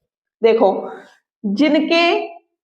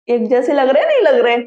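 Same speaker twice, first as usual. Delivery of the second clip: normal talking voice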